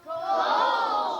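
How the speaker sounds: high, loud one-word shout; rallying shout. Shown as a demonstration. Leader and audience reciting step two, high loud shout. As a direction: call it out for the whole room to echo. Rallying chant of the five steps.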